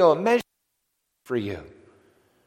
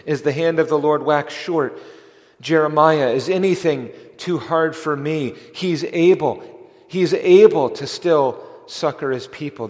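louder, ringing proclamation; fervent preaching; 3 semitones higher, 7 dB louder than usual. Real, not acted. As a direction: proclaim it like scripture from the pulpit. Preacher quotes scripture fervently.

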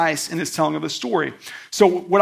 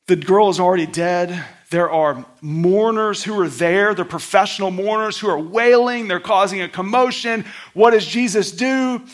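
ordinary talk

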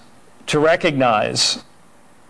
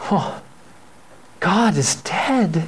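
mock mournful wife; 4 semitones higher, slower than usual. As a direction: sigh it out like a widow in mourning. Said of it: "She says".

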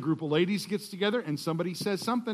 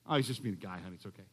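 soothing husband; 12 semitones lower, 9 dB quieter, much faster than usual. Retold or imitated. Husband soothing wife in skit.

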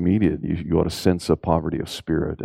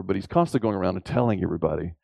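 usual speaking voice

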